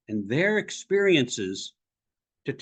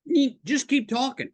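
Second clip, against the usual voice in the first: exasperated listener; 9 semitones higher than usual. Retold or imitated. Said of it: "and you were like".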